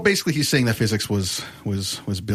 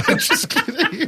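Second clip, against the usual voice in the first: laughing. Real, not acted. Laughing after joke.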